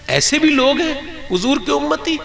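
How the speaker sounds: climbing wail, crying; tearful lament. Real, not acted. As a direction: lament the state of the faithful. Preacher breaks down lamenting.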